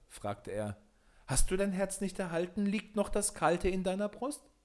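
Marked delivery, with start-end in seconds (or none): 1.3-4.5 high, thin-pitched little man's voice; little glass man. A narrator's reading. Little glass man asks Peter about his heart, high little voice.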